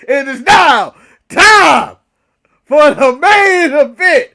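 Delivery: slow, loud, drawn-out announcer call; ring announcer. Pretend. Podcast host introducing the main event to listeners, slow booming announcer voice. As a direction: stretch every word like a ring announcer hyping the crowd.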